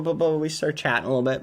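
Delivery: ordinary talk, nothing marked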